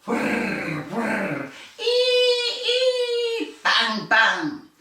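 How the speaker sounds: whirring saw, squealing high drill, banging hammer; tool noises. A narrator's reading. Reading to children, saw, drill and hammer noises. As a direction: make the loud tools. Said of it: "were very loud".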